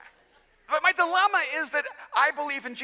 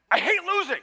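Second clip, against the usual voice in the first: exasperated confession; 6 semitones higher than usual. Retold or imitated. Retold confession at end of spat.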